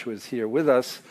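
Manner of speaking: ordinary talk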